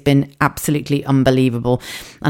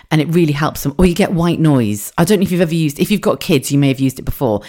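ordinary talk

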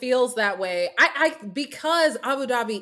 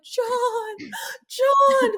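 hammed-up sobbing, much higher; mock crying; 12 semitones higher than usual. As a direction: wail his name in a hammed-up sob.

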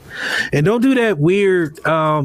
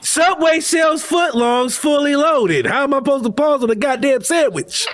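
indignant; 8 semitones higher than usual. Real, not acted. Indignant defence against Brandy.

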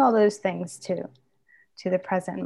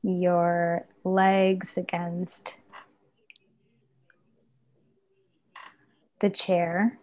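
guided meditation; 3 dB louder than usual. Acted. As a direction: gently guide them into the body. Leads a body-scan meditation.

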